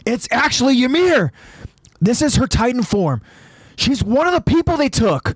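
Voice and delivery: high-pitched excited calling; urgent explaining. A narrator's reading. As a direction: call out to make them understand. Connie urgently explains to the scouts.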